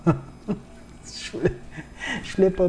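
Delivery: breaking into a laugh mid-word; words dissolving into breathy laughter; real laugh at himself. Real, not acted. Laughs at his own bleeding nose.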